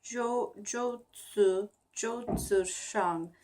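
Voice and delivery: slow Mandarin syllables zhou-zi shang; Mandarin example. Shown as a demonstration. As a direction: sound out zhou-zi shang carefully. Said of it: "I have an example here".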